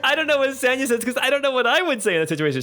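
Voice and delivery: high, fast laughing talk. Real, not acted. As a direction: talk through giggles. GM laughing, flustered.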